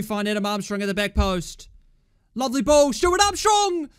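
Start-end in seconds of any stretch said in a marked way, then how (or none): 2.3-3.9 loud, rising cry at the chance; excited call. Real, not acted. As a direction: shout the striker's name high, urging him on. Calling out a live chance.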